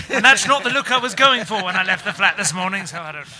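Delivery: usual speaking voice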